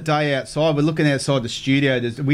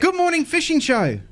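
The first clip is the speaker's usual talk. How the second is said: upbeat radio greeting; 12 semitones higher than usual. Acted. Radio host answers caller line.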